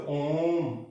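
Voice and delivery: ओम held long and slow; drawn-out Om. Shown as a demonstration. Teacher showing the long ओम sound to students. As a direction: let ओम ring out slowly. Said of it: "सुनो".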